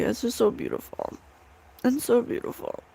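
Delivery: choked up, barely getting the words out; real tearful crying. Real, not acted. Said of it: "I'm crying 'cause it's so beautiful".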